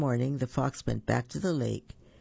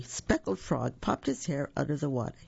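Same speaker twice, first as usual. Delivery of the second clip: usual speaking voice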